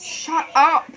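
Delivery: shouting at friend to stop; loud shut up. Real, not acted. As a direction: tell them off sharply. Telling sibling off.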